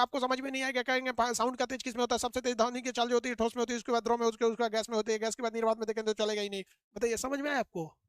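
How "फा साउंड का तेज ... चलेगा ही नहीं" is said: fast, rushed lecture; too-fast teaching. Shown as a demonstration. Teacher mimicking a rushed lecture to show confusion. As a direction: gabble the lesson far too fast. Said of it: "बहुत तेज fast पढ़ाने लगेंगे".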